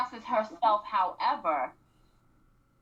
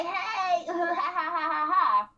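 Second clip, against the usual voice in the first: higher, sing-song hey and giggles; drunk giggly greeting; 7 semitones higher, 4 dB louder, slower than usual. Retold or imitated. Imitating drunk [name] greeting [name], giggling. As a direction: play a tipsy girl greeting the therapist. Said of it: "And she just like".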